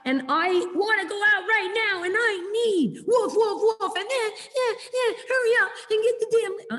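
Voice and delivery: dog demanding its walk right now, high and impatient. Retold or imitated. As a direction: play an impatient dog barking orders. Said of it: "There's a big difference between".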